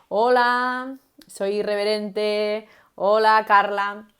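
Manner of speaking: a light touch of a bold, loud hello; bold greeting. Shown as a demonstration. Teacher showing how to compensate with a bold greeting. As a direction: greet them boldly and slowly. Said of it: "compensamos con otros elementos".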